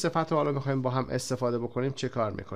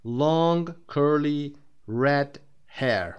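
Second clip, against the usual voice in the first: slow and loud, each adjective spaced out; a teacher modelling adjective order slowly; slow model sentence; 4 semitones higher, 5 dB louder, much slower than usual. Shown as a demonstration. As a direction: spell out the example slowly for the class.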